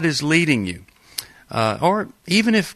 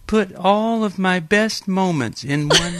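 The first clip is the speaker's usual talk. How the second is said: mock teary child; 7 semitones higher than usual. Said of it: "and she's crying".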